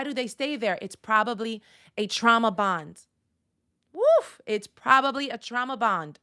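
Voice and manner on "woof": a sudden high, loud "woof!"; high, loud exclamation of disbelief; loud whoop. Real, not acted. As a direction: yelp it out, way up high. Exclaiming at a hard truth.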